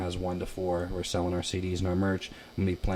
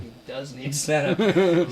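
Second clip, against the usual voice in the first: laughing; 6 semitones higher, 6 dB louder, much slower than usual. Retold or imitated. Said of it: "And I was like".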